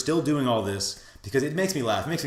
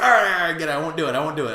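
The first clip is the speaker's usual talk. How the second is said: loud burst of giving in, then easing; mock a flustered surrender; Beerus, exasperated; 4 semitones higher, 6 dB louder, much faster than usual. Beerus gives in after the scolding, exasperated.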